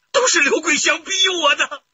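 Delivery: high, loud blaming cry; desperate outburst. Acted. Husband blames his wife, shouting in desperation. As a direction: throw the blame out in a burst.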